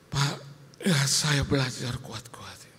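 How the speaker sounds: slow whispered words; wife's whisper. Retold or imitated. Wife tells pastor she's trying, whispered. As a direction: whisper it slowly, worn down.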